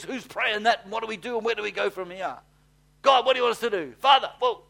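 mock angels calling out, high and loud; excited angels. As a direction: angels at attention, eager for orders. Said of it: "stands to attention and says".

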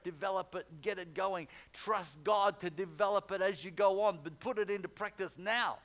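loud, high, urgent exhortation; full-force preaching. Real, not acted. Preacher urging people to begin using their faith, full-force preaching.

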